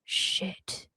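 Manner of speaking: soft unvoiced whisper of shit; whispered realization. Realizing she was wrong, a hushed whispered curse. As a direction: breathe it out in dismay. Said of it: "I thought".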